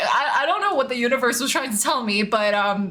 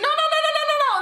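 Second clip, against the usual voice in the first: panicked no; 14 semitones higher, much faster than usual. Retold or imitated. Frantic denial to the neighbor about the alarm, high and quick. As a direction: wave her off in a rush.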